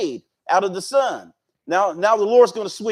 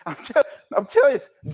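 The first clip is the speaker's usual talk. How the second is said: laughing; 4 semitones higher, 3 dB louder, faster than usual. Real, not acted. Teacher laughing at Jonah's reply.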